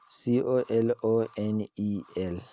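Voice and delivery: ordinary talk, nothing marked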